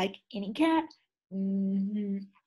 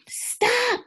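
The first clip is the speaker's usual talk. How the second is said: high loud cry of stop; shout; 4 dB louder than usual. A narrator's reading. Splat cries out to the cats to stop, high and loud. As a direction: yell stop to halt them.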